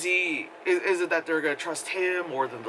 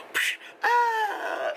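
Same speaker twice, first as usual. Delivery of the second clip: mock grunt. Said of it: "and just like".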